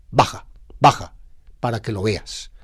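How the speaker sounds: a hint of God's stern command; lightly voiced divine order. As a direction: urge Moses down, lightly voiced as God. God orders Moses down.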